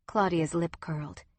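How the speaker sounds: usual speaking voice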